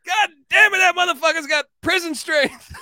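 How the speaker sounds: high, loud amazed shout; imitating a shouting fan. Fans marvelling at the player's rebounds, high loud mock shout. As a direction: yell it like a stunned fan in the bleachers. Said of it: "and they're like".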